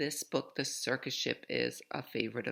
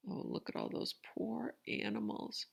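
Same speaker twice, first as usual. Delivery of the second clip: whisper; 7 semitones higher, 6 dB quieter than usual. Real, not acted. Aside to children, unvoiced.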